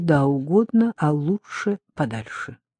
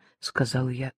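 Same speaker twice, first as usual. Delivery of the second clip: plain reading voice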